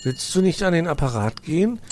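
put-on high, eager boy voice; a curious little fox nudging his uncle; young fox Weißohr. Nephew urges uncle to answer phone, eager boy voice.

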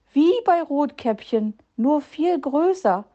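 höher und lebhaft vor Freude; freudig. A narrator's reading. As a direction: kindlich begeistert ausgerufen. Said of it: "freute sich Zwitschi". (freut sich, höher und etwas lauter)

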